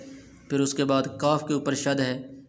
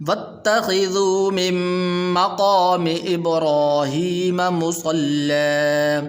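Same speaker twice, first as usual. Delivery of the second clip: slow sung tajweed recitation; Quran recitation; 3 semitones higher, 7 dB louder, slower than usual. Shown as a demonstration. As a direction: chant each word long and melodic. (ترتیل کے ساتھ تلاوت)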